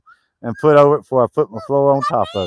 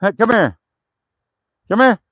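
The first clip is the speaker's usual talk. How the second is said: coaxing a dog; 7 semitones higher than usual. Real, not acted.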